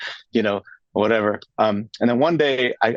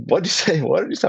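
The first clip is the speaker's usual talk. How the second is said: high, stunned double-take with a laugh; can't believe what he just heard; shocked disbelief; 6 semitones higher, faster than usual. Retold or imitated. Young man hearing a yes on the phone, shocked and laughing. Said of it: "I was shocked".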